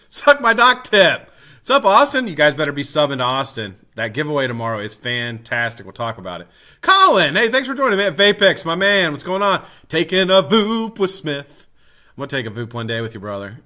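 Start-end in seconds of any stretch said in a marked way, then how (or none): 0.1-2.3 loud, high-pitched shout-out to viewers; shouted greeting. Real, not acted. Streamer calls out greetings to viewers. 6.8-11.7 loud, higher-pitched hello to viewers; excited shout-out. Real, not acted. Streamer calls out greetings to viewers.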